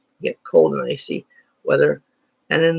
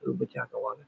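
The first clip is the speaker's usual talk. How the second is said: muttered nonsense word; 3 semitones lower, 14 dB quieter, much faster than usual. Shown as a demonstration. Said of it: "I'm not talking like that".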